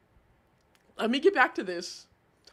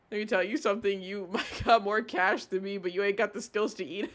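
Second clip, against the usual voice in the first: normal talking voice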